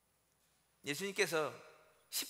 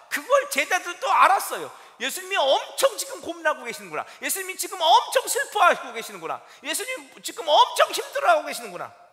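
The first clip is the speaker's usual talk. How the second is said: louder, higher, insistent sermon voice; fervent preaching, louder and higher; full-force preaching; 7 semitones higher, 9 dB louder than usual. Acted. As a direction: drive each line home to the congregation.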